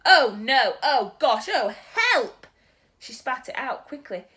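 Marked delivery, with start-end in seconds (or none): 0.0-2.5 much louder cries of disgust. Acted. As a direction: cry out at the taste. Sophie tastes snozzcumber.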